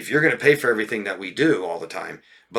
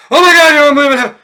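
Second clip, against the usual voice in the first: far higher and louder shriek; mock shrieking drama; 14 dB louder, faster than usual. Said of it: "You don't hear from them again till".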